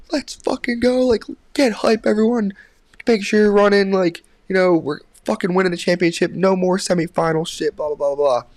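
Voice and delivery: high, sustained rallying cry; pumped-up pep talk, pitched high; hyped-up team message. Retold or imitated. Acting out a hype text to team.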